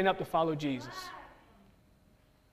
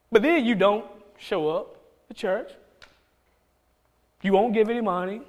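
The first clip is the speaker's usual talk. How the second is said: usual speaking voice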